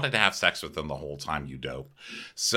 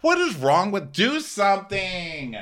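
exasperated plea; 8 semitones higher than usual. Real, not acted. Host pleading with the absent captain, exasperated and high. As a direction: beg the captain to act. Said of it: "host's own exasperation".

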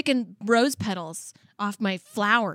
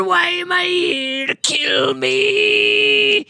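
much higher, loud and very slow, wailing and sobbing to be killed; ancient turtle; 8 semitones higher, 5 dB louder, much slower than usual. Acted. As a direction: ham up a weeping, shouting old turtle begging for death. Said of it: "And the turtle goes".

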